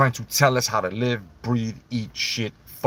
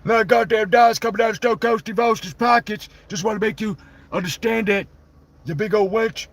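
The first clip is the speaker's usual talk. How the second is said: higher put-on Stone Cold voice; Stone Cold impression; 9 semitones higher than usual. As Stone Cold telling the fan story, a raised put-on voice. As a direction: tell it like Stone Cold himself.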